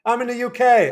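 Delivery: loud, high mock British; put-on British accent. Reading a viewer's chat reply in a hammed-up British accent. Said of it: "My British accent".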